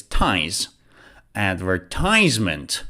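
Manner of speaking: Advertisement with the stress on "ties". Shown as a demonstration. Teacher showing where the stress goes, stress on "ties". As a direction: lean on "ties" in Advertisement, low and falling. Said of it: "the next syllable should be stressed".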